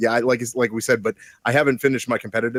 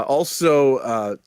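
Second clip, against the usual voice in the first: ordinary delivery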